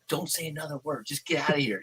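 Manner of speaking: quiet, discreet dismissal; imitating a hushed order. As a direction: say it under your breath, imitating her. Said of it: "Lynn goes like this".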